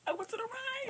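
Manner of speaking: tiny, very high, soft voice; sheepish squeak. Retold or imitated. Daughter admitting wrong turn to mom, high and tiny. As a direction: squeak out the confession.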